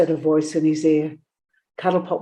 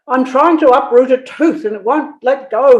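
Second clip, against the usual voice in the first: high, louder pained moan; 6 semitones higher, 7 dB louder than usual. A narrator's reading. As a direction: complain in pain about the tooth. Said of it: "he moaned".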